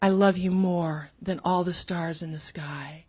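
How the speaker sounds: soft breathy whisper of a sappy poem line. Shown as a demonstration. Reading her bad poem aloud, in a whisper. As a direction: breathe it like a secret love note. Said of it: "I wrote something like".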